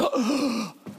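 big horrified gasp; mock shocked gasp. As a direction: gasp as the scandalized women's group. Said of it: "And they went".

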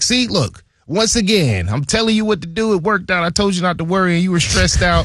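hammed-up, playful gloating; mock high-pitched Colbert at the contract table; teasing GM. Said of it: "he was like".

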